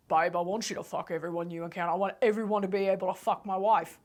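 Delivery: low, a hint of Aussie bloke; quick low Australian husband voice; lightly put-on Aussie man; lightly voiced Australian husband.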